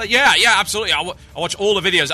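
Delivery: mock-keen, high, loud agreement; lightly acted eager know-it-all; eager retold boasting. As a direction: imitate an over-keen fan blurting out agreement. Retold boast of a game-shop manager.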